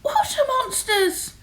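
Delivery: very high, loud shriek of alarm; hammed-up high panic; alarmed cry. A narrator's reading. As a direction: cry out at a monster sighting. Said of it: "And one cried".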